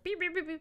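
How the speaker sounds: quick high beep-beep-beep; imitated horn beeps. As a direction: mimic a horn being pushed over and over. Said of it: "he just kept pushing it".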